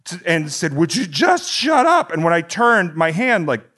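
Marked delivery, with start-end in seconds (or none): 0.7-2.1 high-pitched retold telling-off; fed-up moviegoer confronting a talker; exasperated demand. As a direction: plead and scold at once, pitched way up. Snapping at the talker behind.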